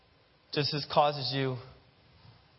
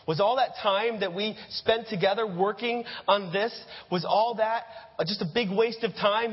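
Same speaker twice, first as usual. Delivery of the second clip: father's high-pitched yell; 7 semitones higher than usual. Acted. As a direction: yell at him for wasting all that time. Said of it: "he yells".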